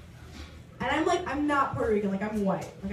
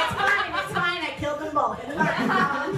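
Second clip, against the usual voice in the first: laughing it off; 5 semitones higher, 4 dB louder than usual. Real, not acted. Joking about killing exes.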